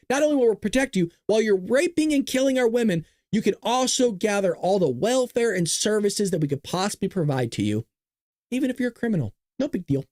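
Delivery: lightly put-on welcoming pitch; mock welcome. Voices the left's message mockingly.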